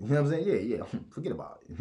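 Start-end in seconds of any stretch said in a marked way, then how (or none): 1.1-1.8 laughing whisper. Acted. Laughing sly version.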